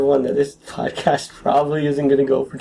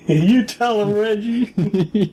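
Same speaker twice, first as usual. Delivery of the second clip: laughing cheer; 7 semitones higher, much slower than usual. Pretend. Cheering heckle for Reggie.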